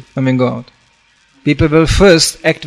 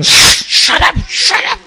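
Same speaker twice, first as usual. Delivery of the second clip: high unvoiced hiss of shut up; much slower than usual. Actor on stage hushes the child, high hissed whisper. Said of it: "he was going".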